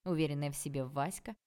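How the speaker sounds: ordinary delivery, nothing marked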